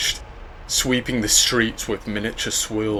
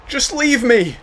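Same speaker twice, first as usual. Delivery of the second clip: high pained cry; 7 dB louder than usual. Acted. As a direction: cry it out in despair. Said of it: "the boy cried".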